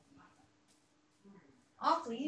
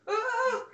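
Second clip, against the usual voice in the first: startled yelp; 10 semitones higher, 8 dB louder than usual. Real, not acted. Jumpscared by a creeper.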